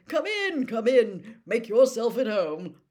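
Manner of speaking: loud, high, hearty welcome; happy. A narrator's reading. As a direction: boom out a jolly invitation. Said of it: "said Bagman happily".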